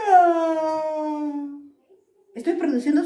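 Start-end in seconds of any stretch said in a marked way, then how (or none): 0.1-1.3 drawn-out loud yawning sound. Shown as a demonstration. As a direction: stretch out a big yawn. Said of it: "si yo digo así". (bostezo largo)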